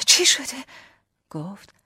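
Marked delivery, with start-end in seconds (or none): 0.1-0.6 loud, high, anxious query; worried question. A narrator's reading. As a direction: ask urgently, voice raised in worry. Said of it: "با نگرانی پرسیدم". (با نگرانی و دلواپسی)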